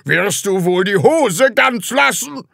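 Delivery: loud, high-pitched scolding yell; furious shout. A narrator's reading. Department head snatches back the trousers, shouting. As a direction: yell to stop the culprit. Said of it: "schrie der Abteilungsleiter".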